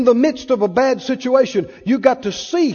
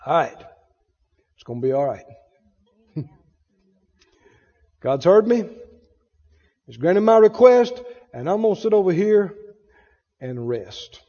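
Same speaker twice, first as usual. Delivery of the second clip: relieved and calm; 6 semitones lower than usual. Retold or imitated. Preacher voicing a believer at peace, low and relieved. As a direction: settle down, let it all go. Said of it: "breathe a big sigh of relief and go".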